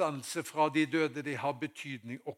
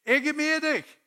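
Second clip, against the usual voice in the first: loud, very high call; Haugesund football shout; 13 semitones higher, 12 dB louder than usual. Shown as a demonstration. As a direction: yell it across the pitch to a teammate. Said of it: "Sånn roper vi i Haugesund". (høyt, lyst rop)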